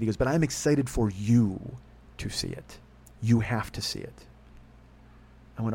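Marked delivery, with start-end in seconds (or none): 0.2-4.3 slow, drawn-out emphasis with pauses; earnest insistence. Retold or imitated. Producer insisting the podcaster see the film, slow and emphatic. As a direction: press it on the listener slowly.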